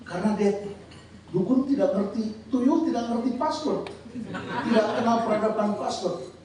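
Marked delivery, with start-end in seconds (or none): none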